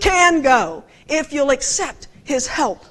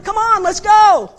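impatient urging; 6 semitones higher, 6 dB louder than usual. Retold or imitated. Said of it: "I was telling them".

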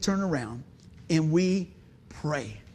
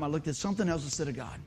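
normal talking voice

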